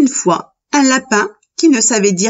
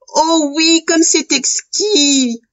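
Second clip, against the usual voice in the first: high, slow savouring voice; delighted polite rabbit; 7 semitones higher, slower than usual. A narrator's reading. Little rabbit praising his mother's soup, high savouring delight. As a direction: relish it high and drawn out.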